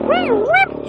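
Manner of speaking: high mock whistle sound; imitated whistle. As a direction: squeal it out like a finger whistle. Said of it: "She's like".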